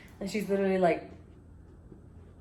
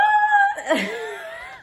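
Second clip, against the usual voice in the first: high shrieking scream; startled scream; 6 dB louder than usual. Retold or imitated. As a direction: shriek in shock. Shocked reaction, scream.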